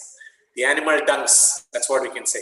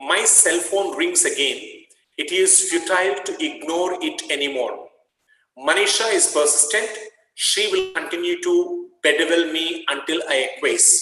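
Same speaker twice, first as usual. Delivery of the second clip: higher, measured reading of the passage; reading aloud; 4 semitones higher than usual. Acted. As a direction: read the story out to the class. Teacher reads passage aloud.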